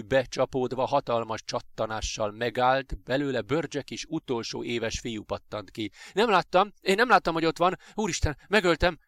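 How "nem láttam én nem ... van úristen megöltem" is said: high, loud horrified cry; frantic, raised-voice panic; panicked stammering. A narrator's reading. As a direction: blurt it out in shock, pitch leaping. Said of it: "dadogta a srác".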